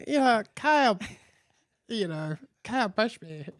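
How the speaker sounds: a hint of a hurt child's voice; lightly imitated little kid. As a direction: tattle like a small kid, lightly played. Retells himself as small hurt kid.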